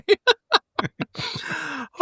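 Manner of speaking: high amused laugh. Real, not acted. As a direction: laugh at the joke. Laughing at the rude joke.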